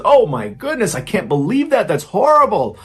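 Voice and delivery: high, put-on gasp of horror; feigned shock. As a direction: play innocent, overdo the shock. Killer feigns shock to police.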